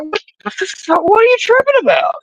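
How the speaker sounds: recreating his startled reaction, very high and exasperated; high-pitched outburst. Retold or imitated. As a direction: voice shooting far up in outraged disbelief. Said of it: "I was like".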